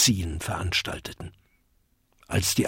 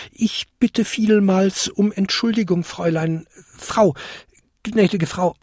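high, flustered apology; stammering; 8 semitones higher than usual. A narrator's reading. Kobold apologising to the old lady, stammering. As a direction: grope for the right title, meekly. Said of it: "stammelte der kleinste Winterkobold".

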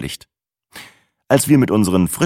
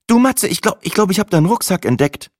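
put-on much higher girlfriend voice; girlfriend Sina, hesitant; 13 semitones higher, 4 dB louder than usual. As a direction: break the news hesitantly, in a high voice. (hohe Frauenstimme, zögernd)

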